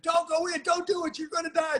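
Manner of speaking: high mock warning at the screen; yelling at the screen. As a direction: cry out to the heroine not to go in. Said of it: "you're at a horror film and it".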